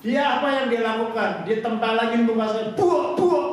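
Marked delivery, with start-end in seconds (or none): 2.7-3.5 high, loud slap noises; imitated face slaps; slapping sounds. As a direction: smack the syllables out like slaps. Slapping face to wake him.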